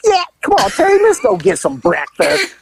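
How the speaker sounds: high, chirpy invitation; suddenly all sweet; cheerful woman. Retold or imitated. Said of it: "She goes".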